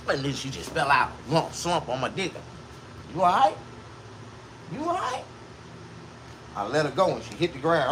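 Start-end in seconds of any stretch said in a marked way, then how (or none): 3.1-5.2 mock worried check-in. Checking on the fallen woman.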